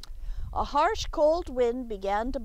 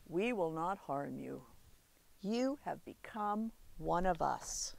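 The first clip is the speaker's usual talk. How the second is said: lower, softer wolf; 5 semitones lower, 6 dB quieter than usual. Acted. The wolf welcomes the child, low and gentle. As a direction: reassure him gently and low. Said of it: "said the wolf".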